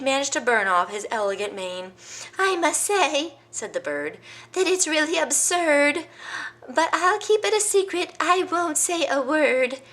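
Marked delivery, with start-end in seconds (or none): 2.4-3.4 nosy bird. Acted. Bird reacts to the news. 4.5-9.8 nosy bird. Acted. Bird promises secrecy.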